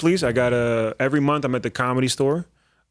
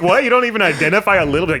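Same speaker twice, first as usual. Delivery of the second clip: incredulous; 7 semitones higher, 7 dB louder, faster than usual. Retold or imitated. Retells own incredulous question.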